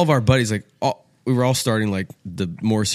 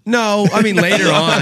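higher, louder quip; loud deadpan joke; 11 semitones higher, 7 dB louder than usual. Real, not acted. Host joking to cohost about his wife, louder. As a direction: toss off the dark joke.

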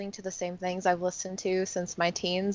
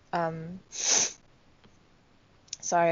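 sneezing; much slower than usual. Real, not acted. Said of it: "I just sneezed a second ago".

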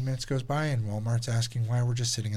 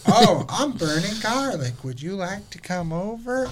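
a lightly voiced, higher, giddy mock Julie; imitating Julie; 8 semitones higher, 4 dB louder than usual. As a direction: play her as a batshit single woman, half-hearted. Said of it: "It's just Julie acting so, like, single, batshit".

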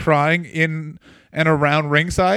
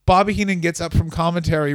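ordinary delivery

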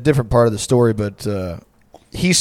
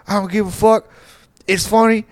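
high-pitched mock giddy protest; laughing defiance; 8 semitones higher than usual. Drunk friend dismissing his friends' worry, high giddy defiance. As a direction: imitate an amused drunk brushing it off. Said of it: "laughing. He's like".

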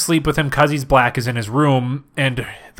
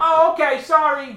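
high squeaky voice; 12 semitones higher than usual. Acted. Brenda on phone.

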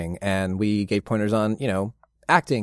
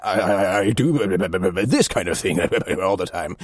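put-on lower, bumbling old-man voice; bumbly old wizard; 4 semitones lower than usual. Shown as a demonstration. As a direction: stammer it out like a doddering old dragonborn wizard. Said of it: "a more bumbly version of Elminster".

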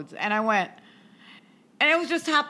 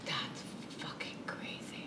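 whispered in disbelief; awed whisper; 21 dB quieter than usual. Real, not acted. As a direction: hush it out, stunned. Listener reacts in hushed awe.

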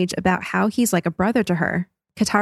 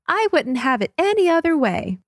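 higher-pitched stagey reply; actress playing Aang; 8 semitones higher than usual. Actress Aang replying to actress Katara, higher and stagey. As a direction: lift it into a theatrical lilt. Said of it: "actress Aang replies".

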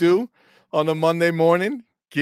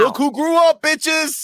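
high, loud gleeful yell; host cheering her rant; hype shout; 13 semitones higher, 5 dB louder, slower than usual. Real, not acted. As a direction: crow it out like a victory. Hyping up the co-host.

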